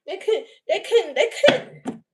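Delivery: high laughing repeat. Real, not acted. Mocking the people who wronged her, laughing high. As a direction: laugh through the words.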